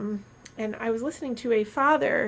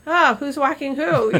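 loud, higher, drawn-out mock remark; calling out loud; 5 semitones higher, 9 dB louder, slower than usual. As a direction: say it out loud for a passer-by to hear. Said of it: "I'll say out loud".

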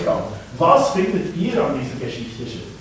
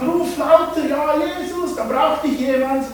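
mock crowd urging; 9 semitones higher than usual. Crowd voiced in story.

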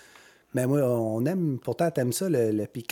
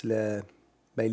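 normal talking voice

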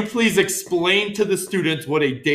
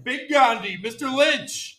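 ringmaster; 6 semitones higher than usual. Acted. Announcing the first co-teacher.

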